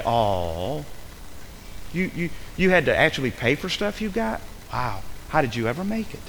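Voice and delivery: soft, incredulous fake pity; mock sympathy. Preacher mocking parent who paid their way, sarcastic sympathy. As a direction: feign hushed wonder at their suffering. Mocks complaint about paying own way.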